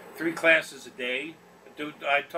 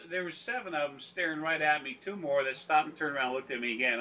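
ordinary talk, nothing marked

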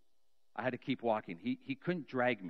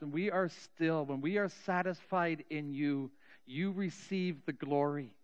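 raised, slow, impassioned praying voice; fervent prayer; 8 semitones higher, 5 dB louder than usual. Real, not acted. Preacher praying over the congregation, fervent and raised. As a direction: pour it out to God, building.

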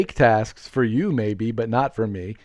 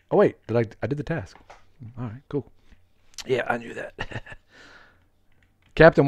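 normal talking voice

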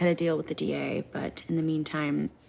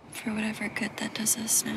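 sad whisper; 2 semitones higher than usual. Acted. Sad reply to confession.

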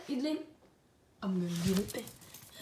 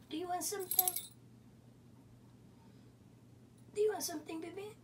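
much higher sing-song pet voice; 11 semitones higher, 8 dB louder, faster than usual. Real, not acted. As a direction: coo at the dog. Baby-talk to pet.

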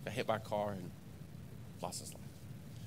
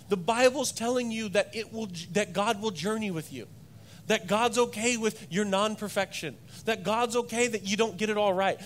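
raised, loud preacher's cadence, each line pressing on okay; full-force preaching; 9 semitones higher, 7 dB louder than usual. Acted. Preacher assures the congregation of God's patience, loud and raised. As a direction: drive it home from the pulpit.

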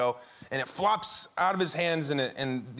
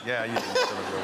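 laughing reply; 6 semitones lower, much faster than usual. Retold or imitated. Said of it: "I was like".

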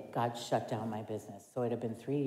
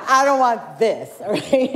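loud, laughing no, pitched up; laughing refusal; 9 semitones higher, 13 dB louder than usual. Retold or imitated. Retelling how she refused the church job, laughing loud. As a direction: blurt it out through laughter.

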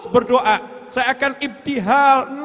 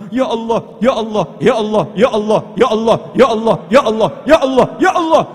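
louder, insistent repeated call; pleading cry; 6 dB louder than usual. Pretend. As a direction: call out to God again and again.

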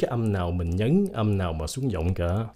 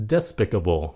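DESPICABLE said as DES-pi-ca-ble; stress on first syllable. Shown as a demonstration. Teacher gives the traditional reading, DES-picable. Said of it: "nhấn âm một".

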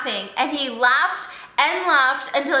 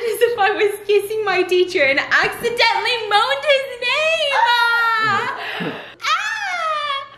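high squealing laughter; embarrassed shriek; 8 semitones higher, slower than usual. Real, not acted.

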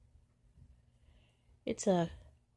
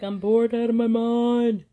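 slow, louder, higher bored whine; voicing the bored cat; 5 semitones higher, 8 dB louder than usual.

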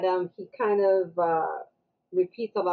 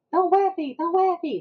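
high-pitched imitation of a kid; excited kid; 10 semitones higher, 3 dB louder than usual. Kid on the slide as water is thrown, excited. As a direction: mimic a child piping up high.

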